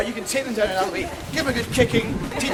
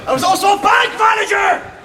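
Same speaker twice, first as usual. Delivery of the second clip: mock scary shout; 9 semitones higher, 8 dB louder than usual. Mock scary boast to crowd.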